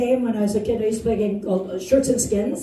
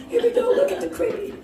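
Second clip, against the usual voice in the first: usual speaking voice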